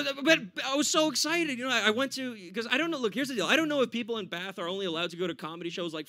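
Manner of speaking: ordinary talk, nothing marked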